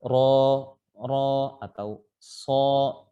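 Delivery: ro and so with lips pushed forward, very slow; wrong rounded-lip ro. Shown as a demonstration. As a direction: push the lips out on ro and so. (ro dan so dibaca dengan memajukan bibir, salah)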